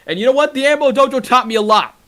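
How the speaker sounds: high-pitched, heated comeback; defiant retort. Real, not acted. Wrestler answering a jab about the Ammo Dojo, defiant. Fires back at rival's jab.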